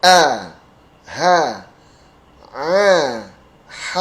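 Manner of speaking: A, H, A, H with a slight smile; smiling A and H. Shown as a demonstration. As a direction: demo each letter with spread lips. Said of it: "Ini agak senyum". (A, H diucapkan agak senyum)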